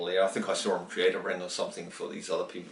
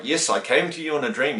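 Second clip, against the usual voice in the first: firm quote; 5 semitones higher, 6 dB louder than usual. Retold or imitated. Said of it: "He said it very firmly".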